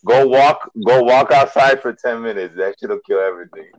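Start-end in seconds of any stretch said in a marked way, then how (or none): 0.1-2.6 louder, higher urging; raised voice. Real, not acted. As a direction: push it out loud. Urging friends outside heat.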